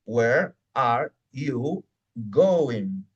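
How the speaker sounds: very slow, a gap after each word; each word separate and drawn out. Shown as a demonstration. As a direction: stretch out "Where... are... you... going". Teacher splits the chunk word by word.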